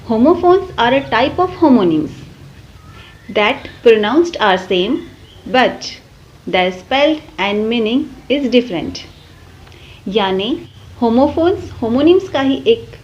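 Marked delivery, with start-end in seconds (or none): 0.1-9.0 reading a definition out slowly for notes; very slow, deliberate classroom definition; slow dictation. Acted. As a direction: spell it out word by word like dictation to a class. Teacher dictates English definition.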